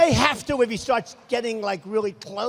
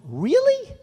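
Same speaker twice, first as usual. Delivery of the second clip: much higher, louder, amazed "Really?"; mock surprise; 11 semitones higher, 7 dB louder than usual. Ambassador reacting to job offer, imitated loud high surprise. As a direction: play her delighted disbelief big. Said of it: "She went".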